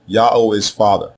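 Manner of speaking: normal talking voice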